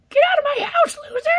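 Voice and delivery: mom yelling. Pretend. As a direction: scream it as an angry mom. Playing his mom in a skit.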